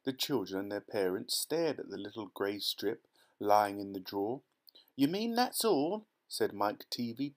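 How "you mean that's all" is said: high, slow, scornful question; disgusted. A narrator's reading. Mike Teavee scoffing at the tiny gum, disgusted. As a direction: sneer it out, higher and slower.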